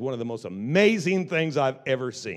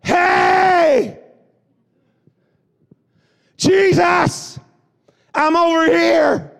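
high shouted call, much louder; crying out; 11 dB louder, much slower than usual. Acted. Blind beggar crying out louder to Jesus, high desperate shout. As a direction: yell across the crowd, slowly drawn out. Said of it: "He cried out all the more".

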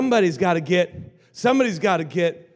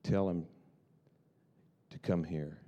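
calm command; 10 semitones lower, 10 dB quieter than usual. Acted. Jesus calmly commands.

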